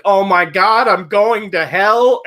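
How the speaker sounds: put-on high, loud, slowed child panic; a kid's mock dread, drawn out; panicked. Kid panics after stealing candy.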